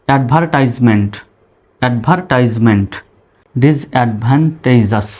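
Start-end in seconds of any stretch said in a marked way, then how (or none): 3.6-5.1 slow, careful ডিসঅ্যাডভান্টেজাস; ডিসঅ্যাডভান্টেজাস sounded out for learners; broken into syllables. Shown as a demonstration. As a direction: say ডিসঅ্যাডভান্টেজাস slowly in its parts. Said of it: "syllable অনুসারে ভেঙে ভেঙে উচ্চারণ করব".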